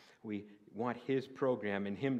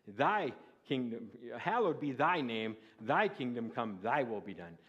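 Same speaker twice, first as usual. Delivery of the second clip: King James recitation; 8 dB louder than usual. Acted. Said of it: "the old King James, I memorized this".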